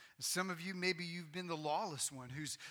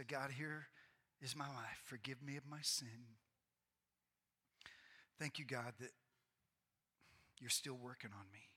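soft, hushed prayer, much softer; guided quiet prayer; 2 semitones lower, 6 dB quieter than usual. Acted. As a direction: lead the people in a humble confession, softly. Leads congregation in personal prayer.

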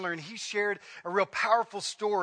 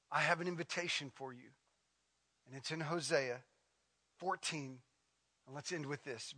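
soft, low, intimate scripture reading; quiet closing invitation; 5 semitones lower, 6 dB quieter than usual. Acted. Pastor reading Hosea to the congregation, softly and low. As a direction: draw them in gently, hushed.

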